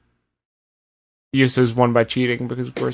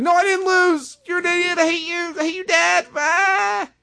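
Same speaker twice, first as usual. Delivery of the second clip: much higher, bratty tantrum voice; whiny brat. Retold or imitated. Host mocking Kevin Owens yelling at Shane, high bratty whine. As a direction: whine it like a kid yelling at his dad. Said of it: "Kevin was like".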